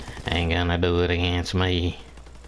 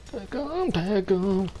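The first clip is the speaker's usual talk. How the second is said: 1 excited; 10 semitones higher than usual. Real, not acted. Urging teammates to mark enemies.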